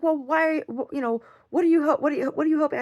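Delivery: high, stammering imitation of a rattled mom; flustered mother. The mother, stunned on the hotel balcony, high flustered stammer. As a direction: play her caught off guard, tripping over words.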